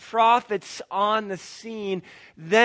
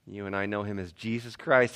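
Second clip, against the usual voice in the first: ordinary delivery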